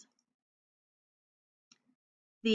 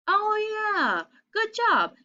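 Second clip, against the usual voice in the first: high, bright cheer; big praise; 10 semitones higher, 4 dB louder than usual. Real, not acted. Teacher praising children for the answer, high and excited. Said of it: "praising the children".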